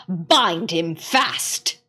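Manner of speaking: low, very slow cold order; witch's command. Acted. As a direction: lay down the order like a witch queen. Witch commands her followers.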